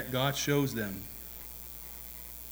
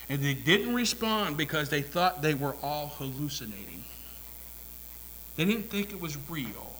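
usual speaking voice

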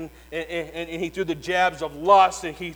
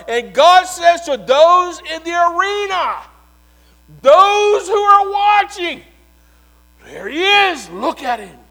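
full-force preaching; 8 semitones higher, 12 dB louder than usual. Acted. Preacher proclaiming the resurrection to his church, triumphant shout. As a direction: shout the victory to the rafters.

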